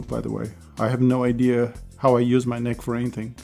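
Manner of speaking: ordinary talk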